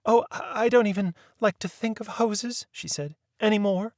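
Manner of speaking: a shy lady wincing at the topic; timid friend. A narrator's reading. Birdie shrinks from hoses.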